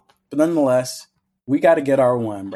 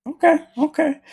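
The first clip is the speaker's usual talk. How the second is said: very high, loud falsetto okay; squeaky high okay; 4 dB louder than usual. Acted. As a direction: squeak it out playfully. Host resets with squeaky high okay.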